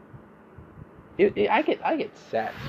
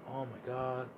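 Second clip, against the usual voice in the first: sleepy groan; 15 dB quieter than usual. Retold or imitated. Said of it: "I'm like".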